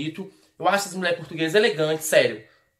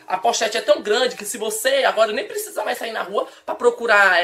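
usual speaking voice

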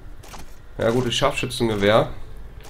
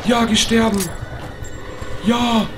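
much higher, slow loud gloating yell; triumphant shout; 11 semitones higher, 4 dB louder, much slower than usual. Real, not acted. Player finishing off an enemy, high gloating shout. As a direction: crow over the kill.

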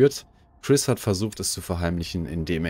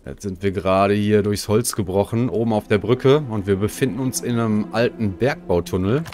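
energetic stream intro; 4 dB louder than usual. Acted. Energetic intro to let's play.

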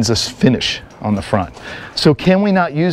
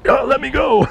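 high, mocking squeal of a fussy shooter; mock whiny voice; 13 semitones higher than usual. Instructor mocking shooters fighting their shirts, squeaky and silly. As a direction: mimic a silly complainer in a squeaky voice. Mocking someone defeating cover garment.

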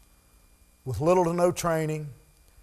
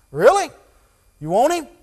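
loud, high disbelieving "Really?"; incredulous; 10 semitones higher, 11 dB louder than usual. Retold or imitated. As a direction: throw it back at him in disbelief. Man at barbecue questions pastor.

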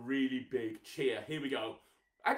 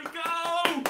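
big shouted go; 12 semitones higher, 6 dB louder than usual. Real, not acted. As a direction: shout it high and loud to launch the clap. Counting kids into a clap.

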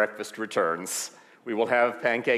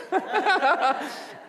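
high laugh. Real, not acted. Laughter at pancake moment.

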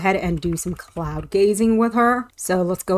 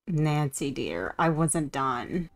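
lower, softer and slow; mock wounded whisper-soft; soft mock plea; 5 semitones lower, 9 dB quieter, slower than usual. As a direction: coo it after her. Pleading softly to sim.

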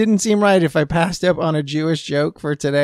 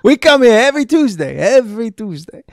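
put-on old diner voice; 5 semitones higher than usual. Joke diner voice.

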